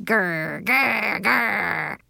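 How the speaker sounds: repeated drawn-out growls, rising; mock-menacing clown growls; scary clown growl. A narrator's reading. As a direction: growl to frighten, building slightly. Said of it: "I made a scary clown face".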